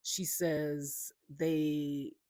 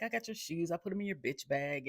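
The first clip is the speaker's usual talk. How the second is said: pitched up high, lightly imitating Mike; a teasing, higher-voiced imitation of Mike; high mock Mike; 3 semitones higher than usual. Mike quoted again, teasing.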